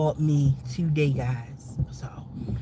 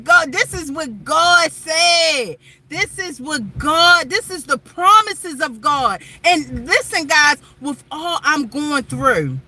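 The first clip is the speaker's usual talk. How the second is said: loud, raised preaching voice; fervent preaching; 9 semitones higher, 6 dB louder, slower than usual. Real, not acted. Telling viewers about Jesus, loud fervent preaching. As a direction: testify with rising fervour.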